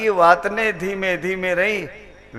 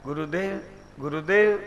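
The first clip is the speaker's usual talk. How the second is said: tearful call; 4 semitones lower, 7 dB quieter than usual. Acted. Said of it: "ખૂબ રડ્યા".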